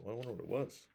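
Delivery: usual speaking voice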